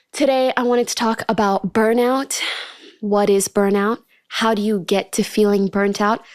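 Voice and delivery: ordinary talk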